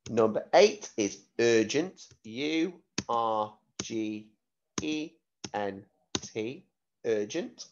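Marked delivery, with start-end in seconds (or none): 2.2-7.7 slow, letter-by-letter spelling. Shown as a demonstration. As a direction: spell each letter clearly for the class.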